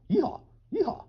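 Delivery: high, hammed-up cowboy whoop; cowboy yeehaw. Recalling learning to be a cowboy, high mock yeehaw.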